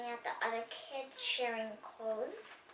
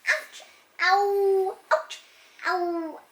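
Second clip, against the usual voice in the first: a string of yelped ouches, louder on the ows; yelled ouches; 6 semitones higher, 11 dB louder, much slower than usual. Acted. Amanda hurt by blocks, yelping loud ouches. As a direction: yelp each ouch, the ows high and loud. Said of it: "Amanda yelled".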